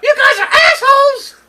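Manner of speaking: high, loud laughing yell; laughing shout. Real, not acted. Laughing outburst at friends.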